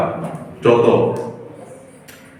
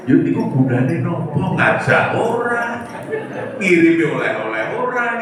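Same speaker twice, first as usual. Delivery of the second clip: higher, teasing busybody voice; mock nosy commenter; 9 semitones higher than usual. Preacher mimics nosy online commenters for his audience, higher and teasing. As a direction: parrot the gossiping commenters.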